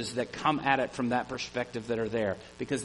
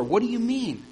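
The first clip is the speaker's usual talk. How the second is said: higher, startled read-aloud question; exclaimed; 9 semitones higher, 5 dB louder than usual. A narrator's reading. Nicodemus bewildered by Jesus, exclaiming. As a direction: Nicodemus caught off guard, reading. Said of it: "exclaimed Nicodemus".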